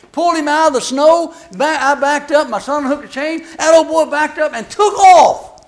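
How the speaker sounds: rising to an outraged punchline; preacher heating up; building indignation. Real, not acted.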